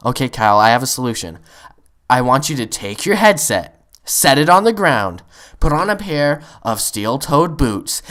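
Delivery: loud, deliberate close-mic address; emphatic instructions. Retold or imitated. Gamer giving Kyle his solution, loud and deliberate. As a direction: lay out each step firmly.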